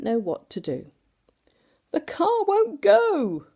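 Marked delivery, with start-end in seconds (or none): 1.9-3.5 much higher, slow whiny complaint; complaining. A narrator's reading. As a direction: whine it like a fed-up dad. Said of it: "complained Mister Bumblebee".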